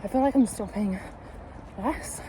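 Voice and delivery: breathless mid-run; panting while still running; out of breath. Real, not acted. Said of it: "I'm absolutely dying today".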